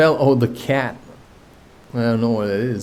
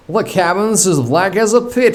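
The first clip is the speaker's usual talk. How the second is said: mildly gibbering; 8 semitones higher, 4 dB louder than usual. A narrator's reading. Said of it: "almost gibbering".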